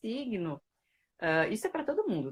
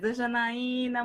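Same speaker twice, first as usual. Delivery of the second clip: normal talking voice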